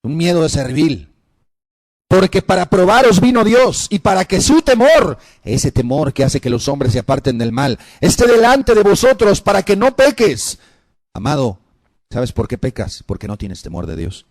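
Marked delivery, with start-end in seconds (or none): 2.1-5.2 loud, raised scripture proclamation; preach. Acted. 8.0-10.6 loud, raised scripture proclamation; preach. Acted.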